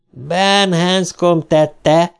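mild howl, dragged out very slowly. A narrator's reading. Disembodied voice accusing Ben, howling. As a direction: stretch each word in a mock howl. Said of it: "üvöltötte a hang".

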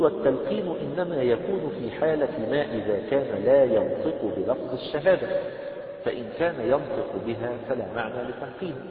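slow, loud, measured recitation of the book; formal text reading. Acted. Teacher reading the book text aloud to the class, slow formal recitation.

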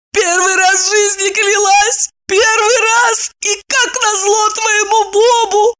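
high, slow wailing through tears; tearful lament. Acted. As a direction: lament as if sobbing over the wheel. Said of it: "Сквозь слёзы причитала она".

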